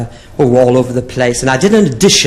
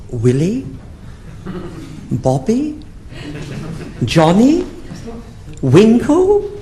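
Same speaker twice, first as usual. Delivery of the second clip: rising questioning guesses, each higher; 9 semitones higher, 4 dB quieter, much slower than usual. Retold or imitated. Comic guessing names to a confused woman, rising. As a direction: offer each name like a hopeful guess.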